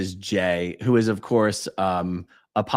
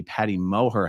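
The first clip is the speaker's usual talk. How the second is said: usual speaking voice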